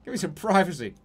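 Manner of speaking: loud, high mock outrage; indignant ghost on the toilet. Imitating a ghost caught on the toilet, yelling indignantly. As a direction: yell like a startled man caught on the toilet. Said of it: "he's just sitting on the toilet like".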